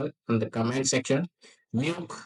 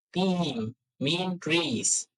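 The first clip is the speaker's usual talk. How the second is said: slow teaching; 2 semitones higher, 3 dB louder, much slower than usual. Shown as a demonstration. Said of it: "teaching slowly".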